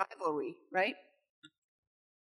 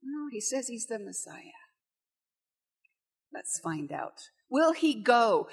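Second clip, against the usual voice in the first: mocking brothers. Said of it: "And there's this mocking".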